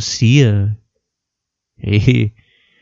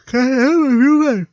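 yawning; 11 semitones higher than usual. Real, not acted. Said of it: "Você para de bocejar".

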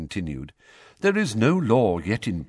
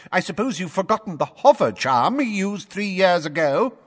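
higher, louder official lecturing the court; pompous blustering minister; 5 dB louder than usual. A narrator's reading. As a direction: lay down the rules like an irritated official. Fudge ranting at Dumbledore.

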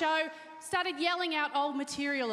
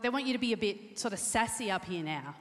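ordinary talk, nothing marked

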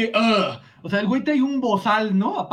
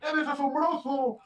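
mock shout; 4 semitones higher, 6 dB quieter than usual. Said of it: "le grita".

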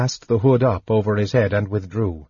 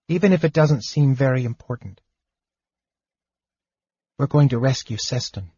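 higher kender voice, firm and decided; kender voice, resolute; 4 semitones higher than usual. A narrator's reading. Tass deciding to rescue Seston, resolute.

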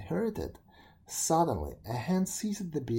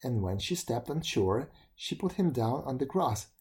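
ordinary delivery

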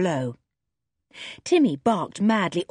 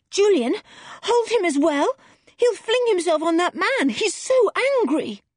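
put-on girl's voice pitched much higher, urgent; high, anxious plea to Julian to hold the dog; George pleading; 11 semitones higher, 3 dB louder than usual. A narrator's reading. George begs Julian to restrain the furious dog, high and anxious.

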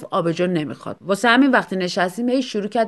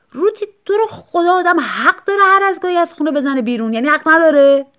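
much higher and louder pleading outburst; exasperated; 8 semitones higher, 7 dB louder than usual. Acted. Husband defending going out, exasperated loud protest. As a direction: blow up in frustrated protest.

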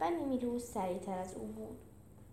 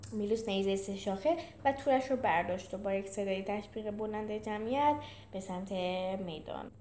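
usual speaking voice

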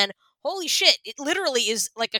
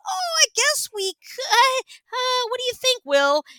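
sweetly angle for the kitten; high coy wheedling to husband; coy coaxing; 13 semitones higher than usual. Retold or imitated.

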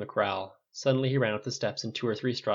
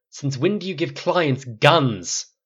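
slow, loud, higher roar; angry roar; 4 semitones higher, 8 dB louder, much slower than usual. A narrator's reading. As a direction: roar the question, drawing it out. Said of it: "Flynn's roar made his horse jerk".

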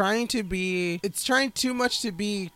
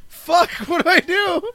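laughing confusion; 8 semitones higher, 8 dB louder than usual. Retold or imitated. Wheaton lost at console.

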